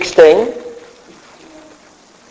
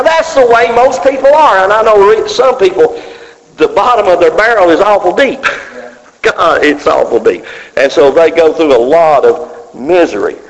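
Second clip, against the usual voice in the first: usual speaking voice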